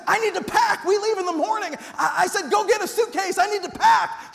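high, loud, thrilled blurting; can't contain the big news; boy shouting his news to mom; excited shout. Retold or imitated. Boy eager to pack.

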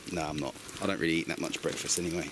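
ordinary talk

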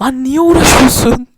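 very loud annoyed yell; exasperated shout. Real, not acted. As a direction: yell it in disbelief. Shouting at clip.

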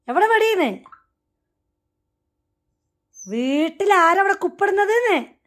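high, loud playful scolding; mock scolding shout. Real, not acted. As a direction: yell at the pet to scare it. (ഉറക്കെ കളിയാക്കി ശകാരിച്ച്)

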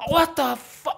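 high, startled exclamation; shocked outcry. Retold or imitated. Poked in the eye by the cellmate, high startled outcry. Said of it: "and I went".

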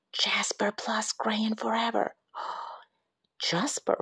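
breathy spooky whisper with a gasp; eerie whisper. A narrator's reading. Narrator whispering the crayon's creepy message. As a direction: hush it like a ghost story.

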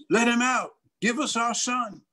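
high-pitched demanding call; pleading parents. Retold or imitated. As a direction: call out to get a child released. Parents plead outside the ark.

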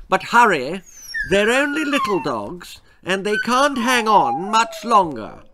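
Queen phoning the Prime Minister at night, regal and loud. Acted. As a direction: announce yourself like royalty. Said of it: "said the Queen".

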